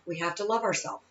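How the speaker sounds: quiet, sincere echo of the line; soft repeat. Shown as a demonstration. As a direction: let it fall to near a hush.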